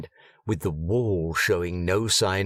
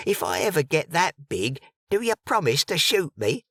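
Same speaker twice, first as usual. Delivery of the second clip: lightly voiced gossips, higher, chattering; gossiping townsfolk; 7 semitones higher than usual. A narrator's reading. Gossiping mourners trade rumours about the dead woman, higher and chatty.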